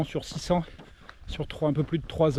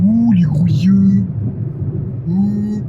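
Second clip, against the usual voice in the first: loud drawn-out ooh; 3 semitones higher, 9 dB louder, much slower than usual. Real, not acted. Mock scary reaction.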